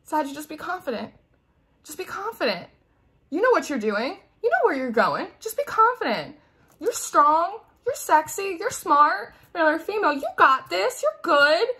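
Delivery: lightly voiced high, cheery angel guides; pep-talk the listener like a gentle spirit guide; angels cheering you on. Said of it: "And they're like".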